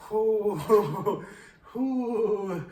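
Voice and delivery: hammy "ohh" with laughter; mock groaning. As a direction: imitate a woozy friend being carried. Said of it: "he's going".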